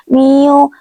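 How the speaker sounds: ordinary delivery, nothing marked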